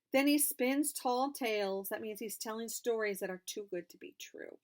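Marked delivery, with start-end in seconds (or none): none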